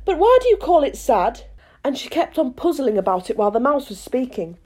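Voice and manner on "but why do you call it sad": put-on young girl voice. Alice speaks.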